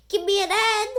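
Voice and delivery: high, loud cheer call; cheerleader shout. Acted. Cheerleaders call to the fans in a high, loud cheer. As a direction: belt it out to the crowd. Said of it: "shouted the cheerleaders".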